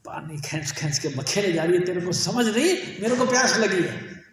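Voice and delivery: shouting. Retold or imitated. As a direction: yell at her to hurry up. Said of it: "चीख के बोला".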